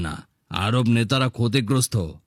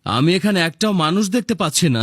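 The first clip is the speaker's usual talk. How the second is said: higher, fast, scornful jab with a laugh; mocking; 6 semitones higher, faster than usual. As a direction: sneer at the bootlickers. (উঁচু গলায় তাচ্ছিল্যভরা হাসি)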